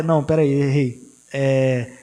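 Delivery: ordinary delivery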